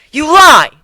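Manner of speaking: shouting; furious shout. Acted. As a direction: yell the accusation. Nadine denies furiously.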